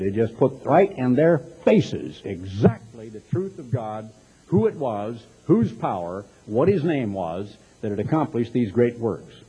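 ordinary delivery

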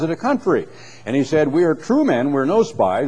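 lifted public reading of scripture. Acted. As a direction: read the King James like from a pulpit. Reads scripture aloud.

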